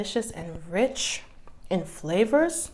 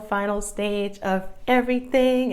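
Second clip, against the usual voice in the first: excited build-up; 2 semitones higher, 6 dB louder, slower than usual. Real, not acted. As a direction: hype it up then settle. Building up to taste test.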